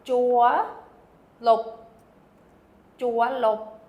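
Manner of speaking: jaw and lop, each short and quick; fast syllables. Shown as a demonstration. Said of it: "I don't have a way to show that it's fast".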